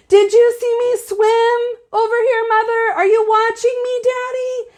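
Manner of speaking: put-on excited child calling.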